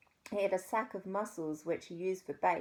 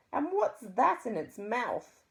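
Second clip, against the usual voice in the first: higher, louder, slow haughty lady; disgusted posh lady; 6 semitones higher, 5 dB louder than usual. A narrator's reading.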